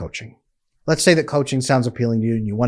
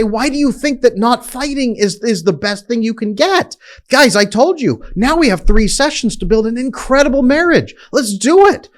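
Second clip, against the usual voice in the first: higher, eager coach rallying the couple; excited pep talk; 10 semitones higher than usual. Retold or imitated. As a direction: pump them up for what comes next.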